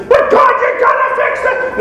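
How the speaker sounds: high, loud, desperate mock shout at God; frantic pleading to God. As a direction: panic and demand that God fix it now. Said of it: "come back and say".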